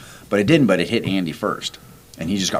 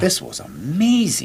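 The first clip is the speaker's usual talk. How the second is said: awed; 11 semitones higher, slower than usual. Retold or imitated. Teammates teary after anthem.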